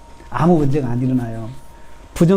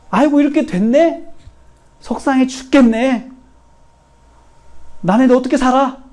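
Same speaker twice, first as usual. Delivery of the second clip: high-pitched mock lament; 한탄하는 시늉; 9 semitones higher than usual. Shown as a demonstration. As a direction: wail it in a raised voice, drawing out the complaint. 본보기로 보인 한탄. (높은 목소리로 푸념하듯)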